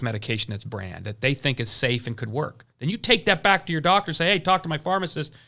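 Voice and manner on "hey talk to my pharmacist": ordinary talk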